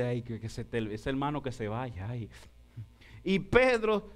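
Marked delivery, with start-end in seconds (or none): 0.0-2.3 grumbling complaint, low and soft. Acted. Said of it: "Y dice".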